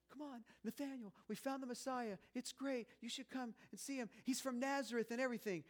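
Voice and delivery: excited Philip. Acted. Philip running to tell his brother, breathless and excited. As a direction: bubble over like a brother with big news. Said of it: "He runs to go tell his brother".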